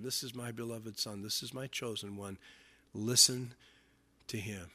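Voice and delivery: very soft, low quotation; hushed reverence. Acted. Preacher recalls the Father speaking from heaven, hushed. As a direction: let the words fall low and hushed. Hushed repeat of God's words.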